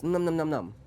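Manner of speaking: mimed munching; playful eating sounds; nibbling noises. A narrator's reading. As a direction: nibble along in little num-nums. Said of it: "He nibbled a little piece".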